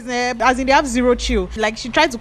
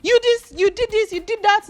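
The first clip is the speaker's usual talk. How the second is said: angry mother; 13 semitones higher than usual. Retold or imitated. The boyfriend's mother chasing Ruth from the gate, shrill mock yell. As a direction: shriek her away from the gate. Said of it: "boyfriend's mother said".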